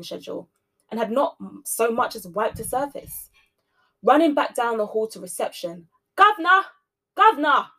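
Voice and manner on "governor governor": loud high shout. A narrator's reading. Cleaner running to reception, shouting for the governor. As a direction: yell his name down the hall. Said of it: "she shouted".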